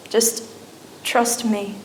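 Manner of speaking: hushed, simple reassurance; God's quiet voice. Retold or imitated. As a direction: let it drop very soft and unhurried. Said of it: "he spoke to me, and he said".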